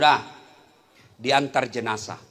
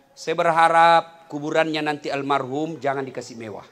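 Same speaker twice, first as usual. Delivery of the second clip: normal talking voice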